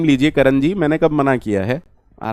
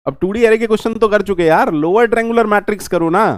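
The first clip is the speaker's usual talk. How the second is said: usual speaking voice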